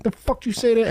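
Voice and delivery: high loud furious retort; angry snap. Retold or imitated. Girlfriend snapping at a rude woman, angry. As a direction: snap at her like you'll fight. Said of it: "your chick is like".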